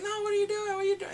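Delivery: high, loud panicked cry; mock panic. Man in the cab panicking at his captor, high yelp. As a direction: yelp it like a man about to be shot.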